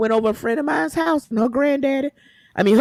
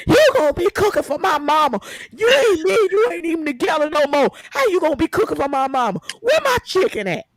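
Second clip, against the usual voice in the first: high, shouting outraged complaint; angry shouting ex; 9 semitones higher than usual. Retold or imitated. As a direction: yell it like an indignant ex. Ex demands chicken, acted shout.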